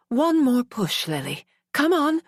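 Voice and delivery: rally her to push; urgent coaching. A narrator's reading. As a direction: coach urging mother in labor. Coaching final push of birth.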